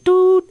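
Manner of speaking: cartoon gun noise; imitated gunshot. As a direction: spit out a toy-gun shot sound. Imitating dad's flashback shot.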